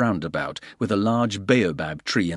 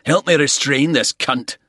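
louder call across the room; 5 semitones higher, 5 dB louder than usual. A narrator's reading. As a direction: call for help. Bald calling for help.

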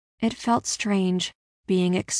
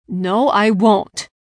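loud, slow, firm refusal; protesting; 7 dB louder, much slower than usual. A narrator's reading. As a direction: dig in and protest. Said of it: "Clayton protested".

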